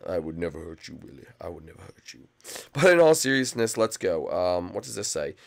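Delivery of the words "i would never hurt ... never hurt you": low, hushed play-acted mother; a hint of a low, soft mom voice; soft-spoken mom. Plays mom answering frightened child.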